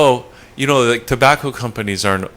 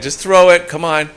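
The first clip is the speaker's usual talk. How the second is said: louder, higher, insistent call; urging; 7 semitones higher, 6 dB louder than usual. Real, not acted. Host urging a guest to throw a bottle over, insistent. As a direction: call out for them to toss it.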